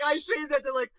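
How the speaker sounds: ordinary talk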